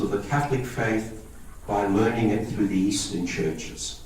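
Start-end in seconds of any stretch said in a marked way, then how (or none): none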